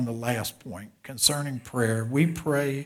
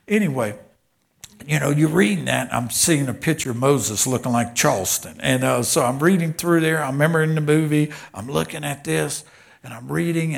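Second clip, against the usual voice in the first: loud, driving preacher cadence; full-force preaching; 2 semitones higher, 9 dB louder than usual. Real, not acted. Preacher telling the congregation about reading Exodus, preaching at full force. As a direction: preach it out, building to the big story.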